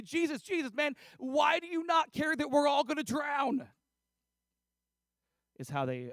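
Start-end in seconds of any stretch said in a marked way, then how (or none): 0.0-3.7 high, loud panicked outcry; panicked accusation. Acted. Disciples to Jesus in the storm, panicked and accusing. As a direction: yell it in alarmed reproach. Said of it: "they're panicking".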